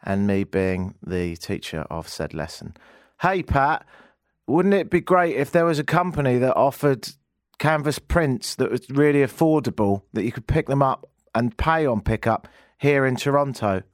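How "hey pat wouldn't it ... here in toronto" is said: a hint of a cheesy ad pitch; mock ad read. Host pitches canvas prints to Pat as a mock ad. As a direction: sell it like a radio commercial, lightly. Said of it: "in the format of an ad".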